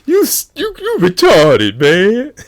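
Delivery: very high, loud mock insult. Fans greeting Jamie Kennedy with insults, squeaky high mock voice.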